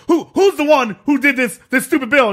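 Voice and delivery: high, loud indignant demand; Dwayne Johnson. Acted. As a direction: confront the culprits like a wrestler. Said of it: "he just walks over and, like, he's like".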